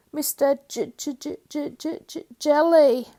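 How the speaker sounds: high stuttering on J; nervous stammer. A narrator's reading. Mister Jelly stammering his name to the tramp. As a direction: stutter out your name in fright. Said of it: "stammered Mister Jelly".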